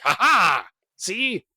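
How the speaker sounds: acted show-off laugh, pitch leaping; triumphant boast. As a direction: crow over a feat of strength. Duncan boasts after snapping wood.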